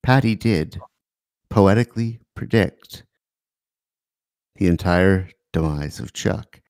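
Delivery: hushed, measured public-radio delivery; soft, low-key NPR narrator. Pretend. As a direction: pause gravely between phrases like a radio essayist. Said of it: "Use your N P R voice".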